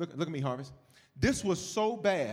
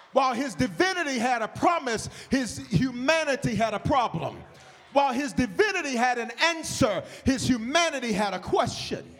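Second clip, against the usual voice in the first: higher, louder, chanted parallel lines; louder, higher chanted preaching rhythm; rhythmic preaching; 8 semitones higher, 4 dB louder than usual. Acted. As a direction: hammer each pair home.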